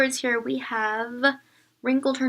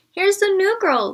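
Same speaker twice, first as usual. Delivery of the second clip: put-on much higher, louder announcing boy; loud announcement; 9 semitones higher, 7 dB louder than usual. A narrator's reading. Boy loudly announcing the new girl to the class. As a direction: proclaim it to the whole room. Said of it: "he announced, so loudly".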